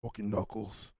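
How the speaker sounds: low, lightly gravelly British voice; a hint of gruff Cockney British. Fan showing how Knuckles should sound, gravelly Cockney. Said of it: "that gravelly British voice".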